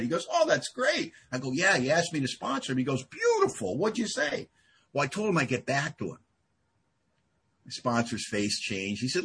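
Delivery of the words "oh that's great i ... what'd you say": delighted reaction. Retold or imitated. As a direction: imitate a sponsor thrilled by good news. Sponsor reacts gladly to good news.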